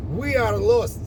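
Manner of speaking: high-pitched mock Russian captain, pleading; squeaky, helpless plea for help. Lost Soviet captain begs the American captain, high pleading voice. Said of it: "And the Russian captain says".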